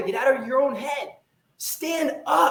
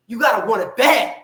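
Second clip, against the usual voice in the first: louder, punched shout; 7 dB louder than usual. Real, not acted. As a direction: drive it home loud. Motivational rant, shouting.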